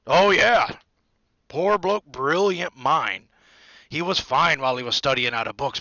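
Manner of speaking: loud, hearty gossiping big man; Hagrid. A narrator's reading. Hagrid explaining the professor's troubles, loud and blunt. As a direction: tell the tale in a booming voice.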